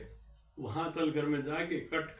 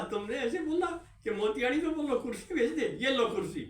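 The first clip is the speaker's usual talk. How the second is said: higher, mock-furious handing over of chairs; angry sarcasm; 9 semitones higher than usual. Boss delivering the chairs to Tiwari, mock angry and high. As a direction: throw the chairs at him, half laughing. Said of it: "गुस्से गुस्से में".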